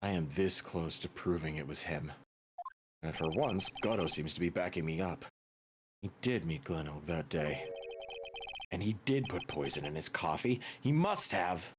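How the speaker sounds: low, soft private thoughts; brooding inner monologue. Acted. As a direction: think it through quietly to yourself, low. Game character's inner monologue.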